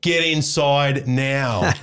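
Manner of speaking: slow, firm, no-nonsense dad command; stern order. Shown as a demonstration. Dad ordering the kids inside after being ignored, slow and stern. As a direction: lay down the law, word by word. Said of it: "That's a change in tone".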